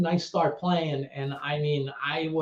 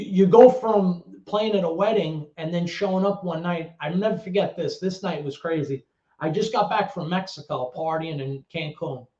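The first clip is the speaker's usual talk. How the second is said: ordinary talk, nothing marked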